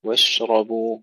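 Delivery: واشربوا with the شين still and the راء heavy; model recitation. Shown as a demonstration. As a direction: recite واشربوا clearly, stressing each sound. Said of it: "وتفخيم الراء وترقيق الباء".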